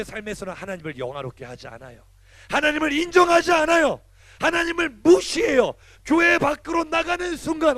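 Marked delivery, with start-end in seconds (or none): 2.5-7.8 fervent preaching. Acted. Pastor rebukes teens who ignore God outside church, fervent.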